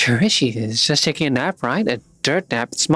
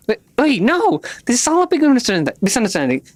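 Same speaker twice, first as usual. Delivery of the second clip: frantic denial; 8 semitones higher than usual. Acted. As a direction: panic and plead fast to be believed.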